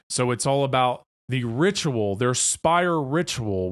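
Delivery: ordinary talk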